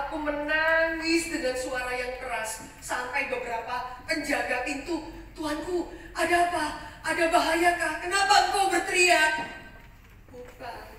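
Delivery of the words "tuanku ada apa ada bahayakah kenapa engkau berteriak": loud, worried, higher calls; alarmed guards. Acted. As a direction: burst in fearing danger.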